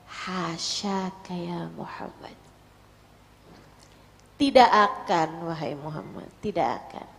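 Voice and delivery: low, slow, hushed comfort; tender reassurance. Acted. Khadijah calms her panicking husband, tenderly reassuring.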